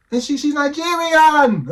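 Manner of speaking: high, slow, loud outburst of disbelief; disbelieving shout. Real, not acted. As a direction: shout it out in astonishment. Exclaiming in disbelief at video.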